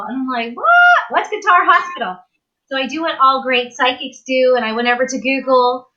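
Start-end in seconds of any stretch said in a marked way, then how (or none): 0.5-2.2 baffled surprise. Retold or imitated. Woman puzzled by a voice in her head, baffled squeal. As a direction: squeal in disbelief, then question it.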